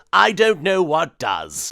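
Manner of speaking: ordinary talk, nothing marked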